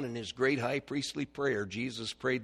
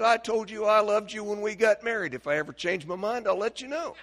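gruff husband; 9 semitones higher, 9 dB louder than usual. Retold or imitated. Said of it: "He said".

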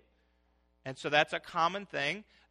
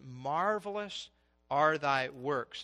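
slow, drawn-out, deliberate; slow scripture reading; 3 semitones lower, slower than usual. Real, not acted. Preacher reads the psalmist's verse to his church, slowly. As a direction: savour each word of the verse.

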